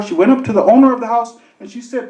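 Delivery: ordinary talk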